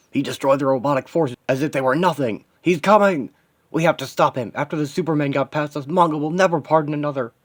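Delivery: high, loud alarmed cry; panicked henchman. Acted. Henchman warning that he's coming, panicked.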